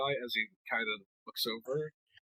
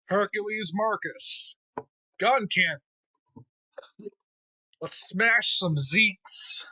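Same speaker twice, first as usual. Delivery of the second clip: high, slow, drawn-out launch announcement; pilot's launch call; 7 semitones higher, slower than usual. Acted. Titular mobile suit pilot launching into battle, drawn-out heroic call. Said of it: "you did the, the launching bit".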